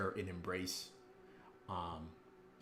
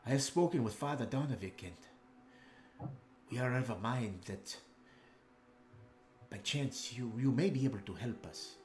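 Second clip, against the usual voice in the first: a lightly voiced noble, a bit higher and earnest; Ismark pleading; 3 semitones higher, 3 dB quieter than usual. Ismark asks the party to escort his sister, lightly voiced earnest plea. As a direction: ask the heroes for help, worried.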